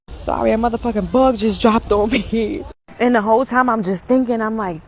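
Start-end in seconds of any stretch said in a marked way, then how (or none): none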